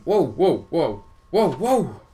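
loud, high bursts of alarm; startled gamer yelps; startled whoas. Real, not acted. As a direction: cry out whoa in quick surprise. Startled during game action.

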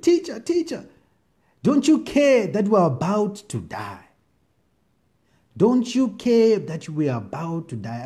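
high, slow frightened plea; panicked disciples. Acted. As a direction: cry out like men about to drown.

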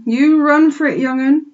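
put-on loud, high, pleading old sheep; urgent old ewe. A narrator's reading. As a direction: urge him to flee. Ma urges Babe.

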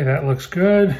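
ordinary talk

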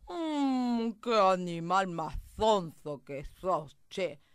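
loud, slow cow drawl; moo, then dismiss it with stretched words; dismissive mooing cow. Acted. Cow calls the mouse silly, lazy dismissive mooing.

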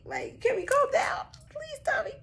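squeaky high mock plea; high pleading. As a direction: plead way up high like a scared kid. Pleading with Tommy.

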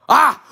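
short, high, loud shriek; sudden loud yelp of fright; startled scream. Acted. Knight finds someone behind, screaming in shock.

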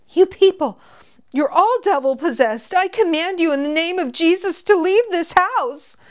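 high, loud zealous yelling. Acted. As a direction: shriek it like a fervent preacher. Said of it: "yelled the girl supporting Jeremiah".

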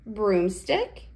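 ordinary talk, nothing marked

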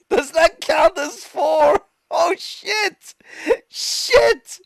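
high-pitched squeal through laughter; cracking up. Real, not acted. Streamer baffled by triplets under the rules, helpless high laughing. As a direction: lose it laughing, voice shooting way up. Cracks up at his own question.

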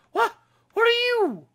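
very high, loud disbelieving squeal; shocked squeal. Real, not acted. Player meeting a weird creature, squealing in shock.

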